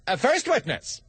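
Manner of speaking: high announcing call; herald call. Acted. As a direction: proclaim it like a court herald. Rabbit heralds the witness.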